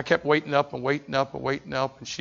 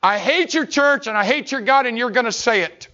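put-on a drunk father's hateful roar; drunk father's rant; 9 semitones higher, 7 dB louder than usual. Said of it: "he said".

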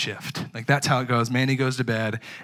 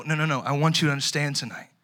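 ordinary talk, nothing marked

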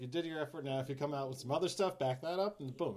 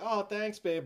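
higher, mock-grateful wife; wife's polite thanks; 6 semitones higher, 4 dB louder, much slower than usual. Husband imagining his wife receiving a gift, mock gratitude. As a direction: imitate a politely pleased reaction. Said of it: "she's gonna be like".

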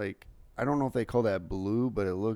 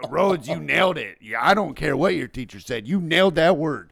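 loud, raised-pitch insistence; mock-emphatic praise; 5 semitones higher, 9 dB louder than usual. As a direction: cheer her on as the delighted dad. Dad in tears cheers daughter on.

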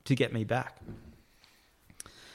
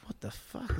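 whispered, baffled mock reaction; retold quiet disbelief; 3 semitones lower, 10 dB quieter, slower than usual. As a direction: imitate a boss whispering in disbelief.

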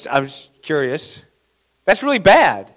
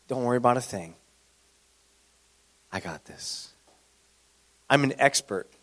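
lower, softer, knowing reassurance; confident schemer; 4 semitones lower, 10 dB quieter than usual. Retold or imitated. Jezebel assures her husband, low, soft and sly. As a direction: purr it like a sly fixer. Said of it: "And she says".

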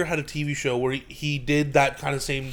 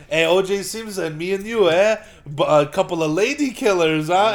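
chummy mock Italian TV host; Italian accent; 3 semitones higher, 5 dB louder than usual. TV host ribbing his guest, mock Italian accent. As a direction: nudge a pal in an imitated Italian accent. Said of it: "like, an Italian".

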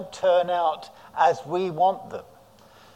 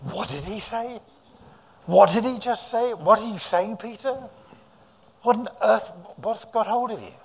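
higher, other voice of baffled fishermen; astonished disciples; 5 semitones higher than usual. Retold or imitated. Disciples in the boat reacting to Peter, higher and baffled. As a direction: play the stunned men in the boat. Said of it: "Others in the boat are saying".

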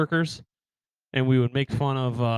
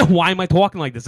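put-on louder, higher Boston-accented complaint; 4 semitones higher, 7 dB louder than usual. Recalling being stuck in it, Boston accent. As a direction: slip helplessly into a Boston accent. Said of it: "in and out of a Boston accent".

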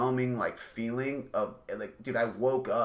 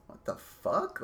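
shocked; 10 semitones higher, 3 dB quieter, much slower than usual. Retold or imitated. Stunned reaction to dad's request.

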